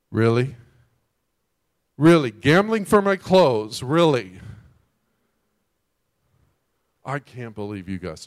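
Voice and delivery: loud, slow, incredulous complaint; hammed-up ungrateful grumble; imagined Jesus complaining from the cross; mock indignant. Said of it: "if he's up on the cross going".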